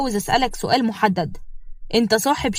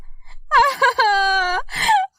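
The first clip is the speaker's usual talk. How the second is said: a high, put-on crying wail; a mock sob, much higher; fake wail; 14 semitones higher than usual. Mai faking tears in front of her father-in-law, high wail.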